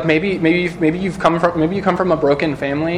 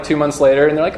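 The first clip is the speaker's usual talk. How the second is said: usual speaking voice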